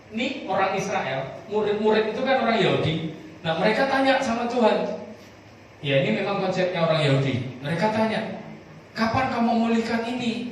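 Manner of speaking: high, loud, driving preacher's voice; fervent preaching. Acted. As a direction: preach it to the congregation with fire. Preaching at full force. (berkhotbah dengan berapi-api)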